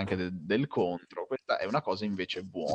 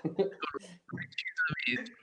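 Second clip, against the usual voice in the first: hushed, breathy whisper; 13 semitones higher, 8 dB quieter than usual. Acted. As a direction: breathe the word out secretly. Dramatic buildup before revealing number.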